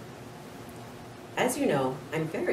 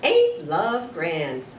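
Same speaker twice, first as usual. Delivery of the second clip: dreamy sigh; 8 dB louder, much slower than usual. Acted. Aldo grinning, leaning forward.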